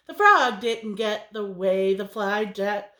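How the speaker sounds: plain reading voice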